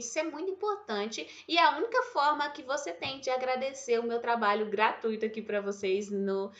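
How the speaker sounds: ordinary talk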